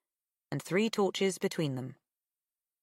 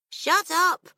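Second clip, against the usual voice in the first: mouth full; 13 semitones higher, 8 dB louder than usual. Acted. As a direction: shut up said through a mouthful. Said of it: "mouth full".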